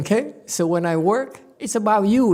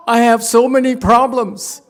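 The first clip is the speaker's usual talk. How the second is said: louder and higher, venting that everything is not okay; half-joking, worked-up protest, voice raised; loud exasperated outburst; 8 semitones higher, 7 dB louder than usual. Retold or imitated. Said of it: "And I said".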